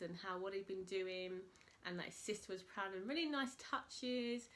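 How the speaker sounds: ordinary talk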